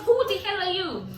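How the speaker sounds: yelling who the hell are you at the guy; mock retold yell. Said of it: "I was like".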